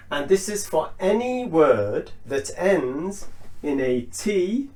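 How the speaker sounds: ordinary talk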